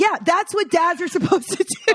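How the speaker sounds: high, mock-obvious agreement; sarcastic. Answering friends' praise of a dad, high sarcastic. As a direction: state the obvious with a sneer. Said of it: "I'm like".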